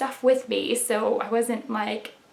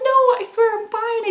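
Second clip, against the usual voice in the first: teary protest; 12 semitones higher than usual. Retold or imitated. Quoting her teary self.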